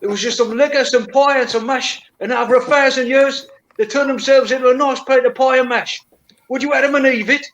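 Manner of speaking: lightly put-on Geordie voice; Geordie accent. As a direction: keep the Geordie patter going. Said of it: "is that Geordie accent".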